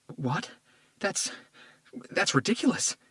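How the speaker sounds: high, stammering disbelief; shocked disbelief. Acted. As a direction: reject the news, stunned. Told her father was murdered.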